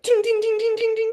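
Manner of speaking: very high, sung dings; ping each star off the wall; ding sound effect. Acted. Throwing stars sound effect.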